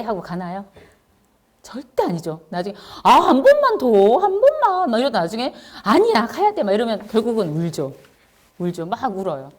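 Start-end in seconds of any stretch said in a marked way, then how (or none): 3.0-4.9 high, loud whining plea; whiny child. Retold or imitated. Child pleading with mom for one more round, high whining voice. 5.8-6.6 insistent mom. Retold or imitated. Mom insisting child must go.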